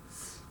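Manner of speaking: "S" as a voiceless hiss, not the letter name; hissed S sound. Shown as a demonstration. Teacher isolating the normal S sound, a voiceless hiss. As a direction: let "S" out as a plain hissing consonant. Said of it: "the sound of S".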